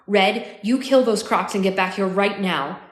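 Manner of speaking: loud, insistent command; urgent order. A narrator's reading. Crying woman ordering her sister back, loud and urgent. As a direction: order them back at once.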